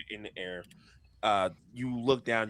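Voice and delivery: normal talking voice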